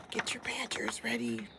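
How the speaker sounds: whispered instruction. Real, not acted. Whispered aside to class.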